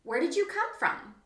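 high, loud and eager; a small wallaby bursting with curiosity; eager little wallaby. Acted. Little wallaby asks the grumpy wombat, high and eager. Young wallaby voiced, excited.

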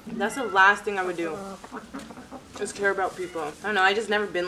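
speaking between bites; talking while chewing. Real, not acted. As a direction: mouth partly full of food. Seen 1: takes forkfuls at 1.9 and 4.7, chewing between lines.

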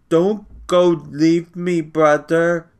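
put-on high, slow, pleading child voice; frightened child crying out. A narrator's reading. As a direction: beg him not to go, scared. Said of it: "he cried".

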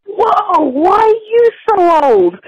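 put-on a little kid gawking at old people; imitating her child. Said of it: "and then she said".

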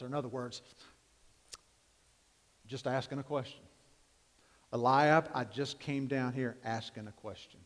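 low, quiet Bible reading; hushed scripture reading before the sermon. Acted.

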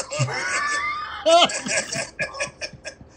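loud, high burst of laughter; real laughter. Real, not acted. Recalling how they called him sick, real laughter.

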